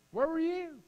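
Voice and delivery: very high-pitched taunting question; Lord's voice. Acted. God asking Job where he was, high and taunting. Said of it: "in the Lord's voice here".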